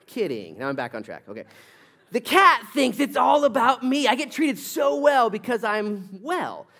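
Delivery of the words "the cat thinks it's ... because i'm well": higher and louder, slow and self-satisfied; smug cat voice. Acted. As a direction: puff up like a cat that thinks it's all about itself.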